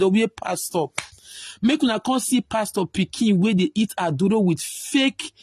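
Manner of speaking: ordinary delivery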